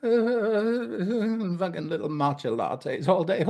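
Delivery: high, slow, laughing sneer at matcha lattes; mock it while laughing; amused high put-on drawl; laughing mockery. Real, not acted. Mocking distracted Instagram mom.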